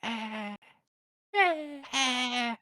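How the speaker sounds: high, strained turtle grunts; turtle noise. Retold or imitated. Imitating a turtle for a friend, high strained grunts. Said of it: "like the turtle".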